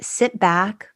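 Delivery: ordinary delivery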